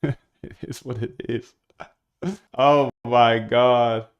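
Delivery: laughing through the words. Real, not acted. Streamer laughing at himself for trolling chat, laughing. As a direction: giggle it out, drawn out and amused.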